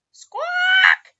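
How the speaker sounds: very high, loud screeching squawk; loud parrot squawk. Acted. The parrot Igor glares at Lou and screeches loudly. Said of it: "Igor squawked with a blow-me-down glare".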